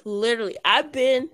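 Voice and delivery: ordinary talk, nothing marked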